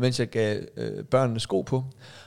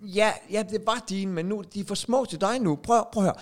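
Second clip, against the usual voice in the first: higher, louder stressed father; frazzled dad; 8 semitones higher, 3 dB louder than usual. Acted. Father telling My not to take Vega's shoes, raised and stressed. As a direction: scold the kids in a rush.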